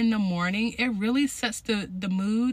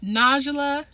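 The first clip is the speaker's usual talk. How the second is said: very slow, emphatic affirmation; booming motivational speech; 4 dB louder, much slower than usual. Shown as a demonstration. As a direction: proclaim it to yourself. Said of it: "Now put your name in there".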